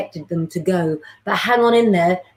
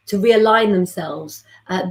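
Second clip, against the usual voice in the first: usual speaking voice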